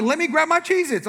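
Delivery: high-pitched comic fluster; acted panicky whine; flustered protest. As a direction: scramble for your snacks, voice way up.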